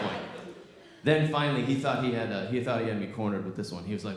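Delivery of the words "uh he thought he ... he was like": usual speaking voice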